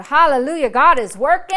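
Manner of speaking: loud, demonstrated praise; acting out joyful praise; rejoicing. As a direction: burst into a loud hallelujah. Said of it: "I start rejoicing".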